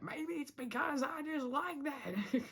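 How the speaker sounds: high, slow put-on cartoon accent; Hop Pop. Vlogger joking about doing Hop Pop, high put-on accent. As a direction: slip playfully into the high character voice, stretching words. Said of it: "doing the Hop Pop accent".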